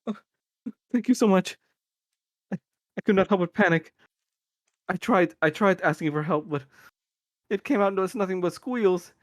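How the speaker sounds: put-on a rescued girl pouring out relief; high frightened girl. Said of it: "she says".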